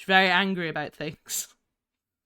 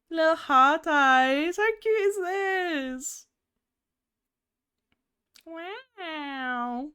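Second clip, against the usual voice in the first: gushing over cuteness; 8 semitones higher, much slower than usual. Real, not acted. Shows the heart-eyes expression.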